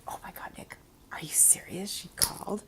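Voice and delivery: whispered, breathless disbelief; shocked whisper. Real, not acted. Mother whispering to her son in shocked disbelief. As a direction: hush it, stunned and giggly.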